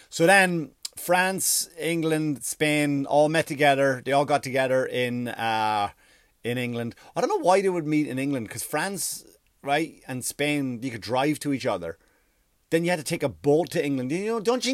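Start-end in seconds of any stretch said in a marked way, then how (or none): none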